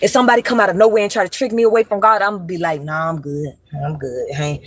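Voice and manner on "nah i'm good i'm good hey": low, soft brush-off; dismissive refusal. Retold or imitated. Telling off whoever tempts her from God, dismissive refusal. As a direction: turn it down quietly in a lower voice.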